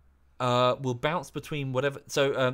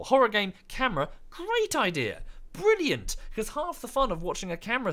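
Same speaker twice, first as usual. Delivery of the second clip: higher, louder mock enthusiasm; sarcastic praise; 8 semitones higher, 3 dB louder than usual. Ranting streamer mocks face cams with high sarcastic praise. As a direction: gush sarcastically over a bad idea.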